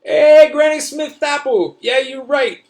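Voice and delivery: high, loud, slow yell across the crowd; yelling back. Acted. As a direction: call out to your flag man far away. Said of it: "I yell back to my flag man".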